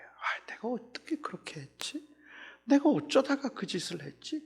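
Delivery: whispered self-reproach, rising into a soft voice; remorseful whisper. Retold or imitated. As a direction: murmur it to yourself in shame. Remorseful inner voice of the guilty. (부끄러워 혼잣말로 속삭이듯)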